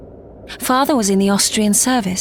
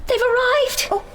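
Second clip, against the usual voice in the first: high flustered cry; breathless girlish fluster; flustered alarm; 14 semitones higher than usual. Acted. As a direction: panic that guests are here. Panics at guests' arrival.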